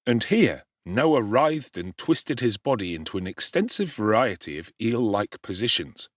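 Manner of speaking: ordinary delivery, nothing marked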